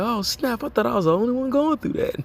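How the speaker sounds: high, excited amazement; amazed. Retold or imitated. Brother discovering a shared struggle, in high amazed voice. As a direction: let the pitch jump with disbelief. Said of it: "You be like".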